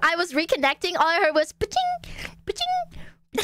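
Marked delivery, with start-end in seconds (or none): 1.5-3.4 imitated chime. Imitates game crafting sound.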